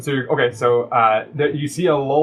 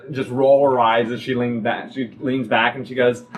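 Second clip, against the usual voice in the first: ordinary delivery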